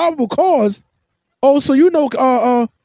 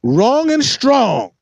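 slow emphatic; 3 semitones higher, much slower than usual. Real, not acted. Catchphrase, drawn out.

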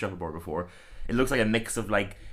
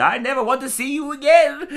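much higher, louder fifties lady; stilted old movie melodrama; 7 dB louder than usual. Retold or imitated. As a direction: cry it out like old film melodrama. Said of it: "she's like".

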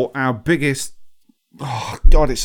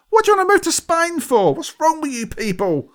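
exasperated rant; 13 semitones higher than usual. Real, not acted. Ranting at property-show guests.

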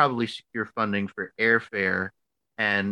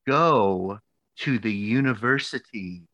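each phrase stretched and set apart; mock-stern, very slow instructions; slow, deliberate ordering; slower than usual. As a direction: imitate a mentor spelling it out. Retold advisor spelling out orders.

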